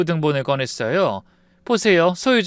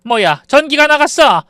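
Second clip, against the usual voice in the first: startled shout; 11 semitones higher, 8 dB louder than usual. Acted. Power cut, in-laws startled.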